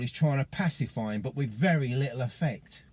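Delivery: normal talking voice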